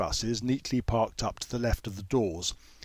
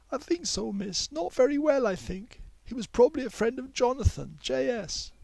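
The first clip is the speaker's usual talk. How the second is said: timid schoolgirl checking in; girl Mei Yi; 13 semitones higher than usual. A narrator's reading. Mei Yi asks the teacher if she is busy, higher girl voice.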